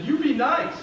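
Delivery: mock-stern instruction to behave; bossy pointing scold; high stern order. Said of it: "and I said".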